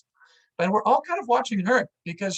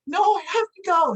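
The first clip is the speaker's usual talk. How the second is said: high, loud desperate cry; panicked insistence; 12 semitones higher, 6 dB louder than usual. Retold or imitated. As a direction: blurt it out high and frantic. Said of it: "She's like".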